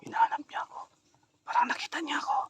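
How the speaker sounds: hushed whisper while hiding. Real, not acted. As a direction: breathe it so she won't hear. Hiding from the witch.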